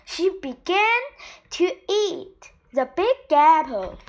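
ordinary delivery

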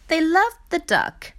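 ordinary delivery, nothing marked

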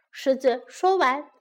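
usual speaking voice

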